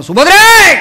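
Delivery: full-force, high warrior shout; lion's roar. Acted. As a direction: roar her name like a war cry. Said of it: "सिंहनाद करणारा अर्जुन".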